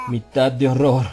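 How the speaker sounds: ordinary delivery, nothing marked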